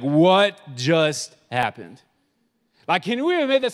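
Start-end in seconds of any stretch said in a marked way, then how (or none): 0.0-2.0 slow, lower, dazed "What just happened?"; stunned onlooker. Retold or imitated. Church kids acting out a stunned onlooker, slow and dazed. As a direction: drag it out like you're in shock. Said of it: "We'd be like".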